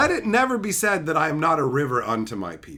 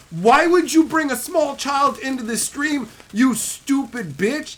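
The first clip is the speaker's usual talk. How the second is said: higher-pitched exasperated tirade; angry scolding; 6 semitones higher, 4 dB louder than usual. Real, not acted. Streamer berating dad who brought his kid, angry. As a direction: lay into them in disbelief.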